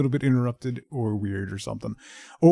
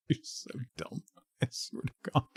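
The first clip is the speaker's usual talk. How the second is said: whispered at the dog; 10 dB quieter than usual. Real, not acted. Muttering fondly at the dog.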